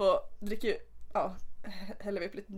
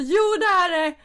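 loud, high indignant protest; insist, outraged, louder and higher; upset insisting; 9 semitones higher, 11 dB louder than usual. Retold or imitated. Girl arguing the Italian guy about racism, mock indignant protest. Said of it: "Och jag blev så upprörd".

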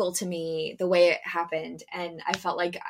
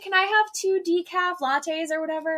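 higher, airy ditzy order; customer voice; 10 semitones higher than usual. Retold or imitated. Next customer ordering at the drive-thru, high and ditzy. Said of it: "she's like".

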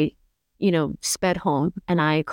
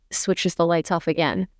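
normal talking voice

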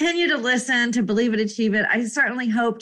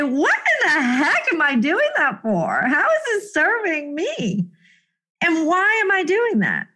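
mock self-questioning; 7 semitones higher than usual. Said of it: "look at yourself and say".

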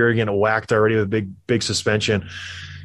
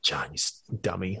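exasperated aside; 7 semitones lower, 12 dB quieter than usual. Retold or imitated. GM scolding in the hearing.

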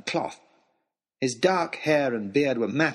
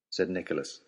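ordinary delivery, nothing marked

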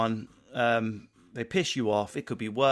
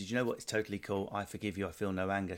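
mock serene calm; 4 semitones lower, 6 dB quieter than usual. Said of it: "I might say to Lindsay".

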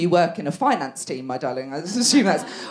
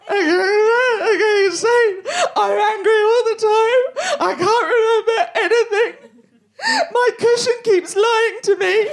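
blub it out as if falling apart; mock sobbing; 13 semitones higher, slower than usual. Sobbing to her doctor about going mad, crying wail.

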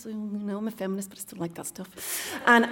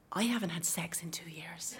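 laughing whisper; 3 semitones lower, 12 dB quieter than usual. Retold or imitated. Confession said through a laugh.

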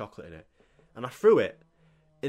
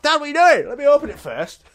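very loud, high protest; outraged shout; 11 dB louder than usual. Retold or imitated. Kid stopping dad binning presents, outraged shout. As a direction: yell at dad to stop.

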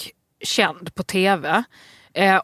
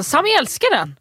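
mock proud; 7 semitones higher than usual. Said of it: "bara så".

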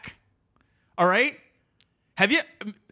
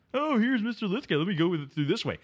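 mocking imitation; 2 semitones higher, 4 dB quieter than usual. Engineer mimicking the client sneaking after Mister Lithgow, mocking. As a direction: lightly imitate a cute, scheming client.

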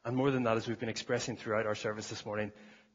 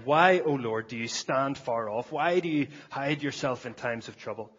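loud, ringing psalm reading; fervent preacher reading scripture; 5 dB louder than usual. Acted. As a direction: proclaim the psalm's cry to God. Reads psalm aloud with force.

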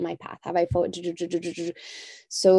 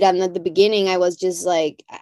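normal talking voice

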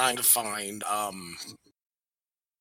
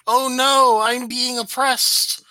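high, slow sarcastic whine; mock her fake oppression; mocking whiny victim; 9 semitones higher, 4 dB louder, slower than usual. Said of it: "crap that she's going on".

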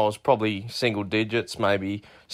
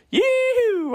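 a big high yee-hoo, loud; rowdy bush whoop; whooping yell; 3 dB louder than usual. Real, not acted. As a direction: whoop it out like a cowboy. Caller whoops before the joke.

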